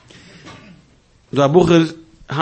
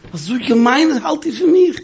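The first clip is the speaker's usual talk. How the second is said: high mock voice; 11 semitones higher than usual. Quoting the rebbe's question.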